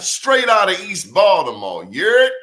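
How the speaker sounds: loud, high hometown hype; proud hometown shout-out; hyped shout. Real, not acted. Hypes her East Baltimore roots.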